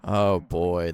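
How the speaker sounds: ordinary delivery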